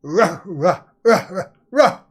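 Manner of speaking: imitated gruff-free "ruh ruh" woofs; dog barking. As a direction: woof like a dog in short bursts. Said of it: "That was a dog".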